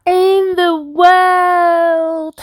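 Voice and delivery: high, very drawn-out cheer; triumphant finish. A narrator's reading. Narrator ending the reveal, high drawn-out delight. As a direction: stretch each word up high.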